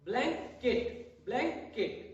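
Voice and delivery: ब्लैंकेट stretched slowly with a gap between ब्लैं and केट; syllable break shown. Shown as a demonstration. Teacher demonstrating the syllable break in ब्लैंकेट. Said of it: "रुकावट सा महसूस हो रहा है".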